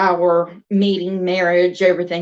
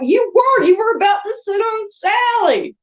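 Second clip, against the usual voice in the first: put-on high indignant child scolding; mad little girl; 11 semitones higher than usual. Little girl scolding brother for nearly sitting on her doll, high and indignant. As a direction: scold him like an offended toddler. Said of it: "I got mad. I'm like".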